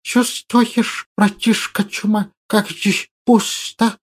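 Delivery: quiet, slow lisping hiss; hissing creature voice. Acted. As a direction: hiss like a small monster. Hissing creature sidekick speaks.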